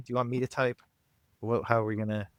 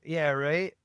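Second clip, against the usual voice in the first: usual speaking voice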